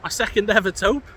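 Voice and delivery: excited. Real, not acted. Excited about a rare catch.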